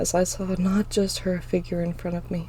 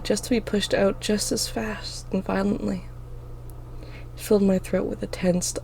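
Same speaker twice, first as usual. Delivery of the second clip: ordinary delivery, nothing marked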